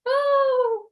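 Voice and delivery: gasp, then a high, loud "oh"; reenacted squeal of recognition after a sharp breath; delighted gasp. Seeing two friends out in space, delighted gasp.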